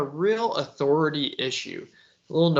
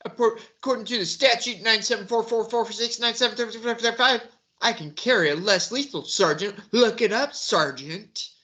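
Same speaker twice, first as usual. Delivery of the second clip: higher, mocking parody of a smug driver; mocking Jeremy; 7 semitones higher than usual. Commentator mocking Jeremy's lines to the sergeant, higher smug parody voice. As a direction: needle him in a raised, smug copycat voice.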